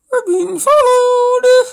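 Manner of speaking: very high, loud, drawn-out wail; mock panicked wail. Reader overplaying the alarm of being followed, mock panicked wail. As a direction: stretch it into a hammed-up cry of alarm. Hammed-up repeat of the story line.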